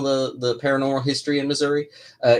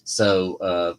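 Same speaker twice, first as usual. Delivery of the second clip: normal talking voice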